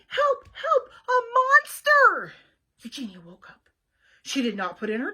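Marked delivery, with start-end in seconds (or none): 0.1-2.3 terrified shout. Acted. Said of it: "shouted Baby".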